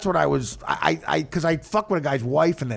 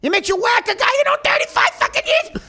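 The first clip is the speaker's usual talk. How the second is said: higher, louder Pesci mob shout; 4 dB louder than usual. Retold or imitated. Comic imitating Pesci's line from the clip, mob shout. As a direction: yell it like a wound-up Pesci. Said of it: "Pesci voice".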